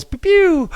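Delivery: playful high gun noises; finger-gun pew pew. Pretend. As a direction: pew pew like toy pistols. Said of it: "giving you guys the little pistols".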